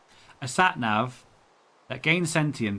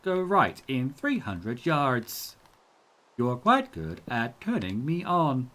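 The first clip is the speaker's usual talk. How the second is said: slow, stilted robot sat nav voice. Shown as a demonstration. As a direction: speak each word like a robotic sat nav. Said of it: "a kind of stilted robot voice".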